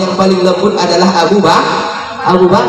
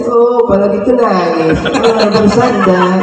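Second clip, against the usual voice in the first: high, slow mocking crone; mocking old woman; 4 semitones higher, slower than usual. Blind old woman taunting Abu Bakar for crying, high mocking crone. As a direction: taunt him with a drawn-out crone voice.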